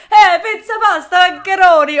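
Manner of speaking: loud, high cartoon Italian; exaggerated Italian accent. Guest protesting how Italian they sound, mock Italian accent. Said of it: "my accent is not as, like, Italian as".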